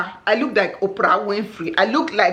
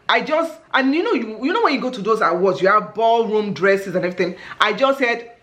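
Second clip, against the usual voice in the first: normal talking voice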